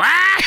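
loud high shriek; retold scream. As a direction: scream like a horrified waiter. Restaurant worker's scream acted out.

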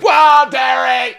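high, slow, loud shout; mock amazed shout. Imitating the wife's stunned welcome. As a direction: gasp it out like a long-lost reunion.

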